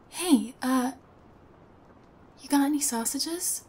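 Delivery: normal talking voice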